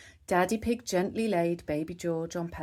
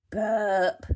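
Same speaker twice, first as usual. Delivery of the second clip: put-on baby burp sound; 3 semitones higher, 6 dB louder than usual. A narrator's reading. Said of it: "George burped".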